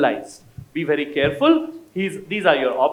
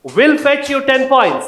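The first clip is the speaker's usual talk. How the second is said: proud announcement; 6 semitones higher, 6 dB louder, slower than usual. Real, not acted. Quizmaster awards correct answer.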